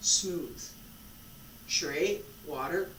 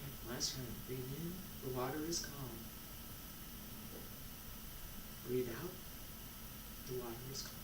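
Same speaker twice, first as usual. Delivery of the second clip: guided meditation; 5 semitones lower, 15 dB quieter, faster than usual. Acted. Teacher guiding students through breaths, soft meditation voice. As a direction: lull them into calm breaths.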